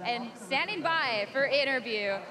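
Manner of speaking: ordinary talk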